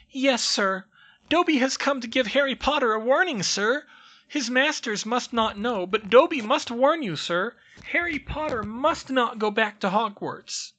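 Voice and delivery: very high, loud squeaky elf voice, urgent; a tiny servant pleading a warning; anxious house elf. Acted. House elf warns Harry, squeaky and urgent. Dobby's voice held.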